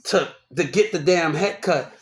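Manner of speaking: usual speaking voice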